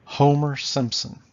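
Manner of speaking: HO-mer SIMP-son, loud first beats; strong-weak, strong-weak on "Homer Simpson"; two trochees. Shown as a demonstration. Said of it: "Two trochees".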